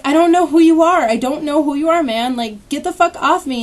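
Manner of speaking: loud, insistent retold protest; retold screaming. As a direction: re-enact yelling at an attacker. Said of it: "I just kept screaming at her".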